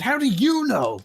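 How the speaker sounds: loud dismissive mock challenge; scornful. Shown as a demonstration. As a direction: demo a put-down: what would you know. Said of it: "That's obviously saying, "What do you know? You're stupid".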